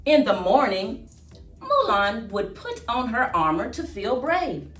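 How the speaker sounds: plain reading voice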